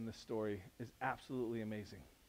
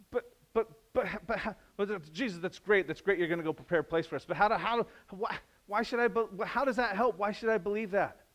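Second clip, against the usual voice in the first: higher, louder sputtering doubt; doubting objection; 7 semitones higher, 4 dB louder than usual. Retold or imitated. A doubter argues with Jesus, high and sputtering. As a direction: protest in disbelief. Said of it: "skeptic objects".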